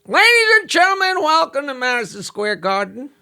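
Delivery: high, booming ring announcer voice; boxing ring announcer. Retold or imitated. Comic telling a roast joke, voicing the ring announcer. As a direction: call it like a ringside announcer. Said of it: "the announcer comes out and says".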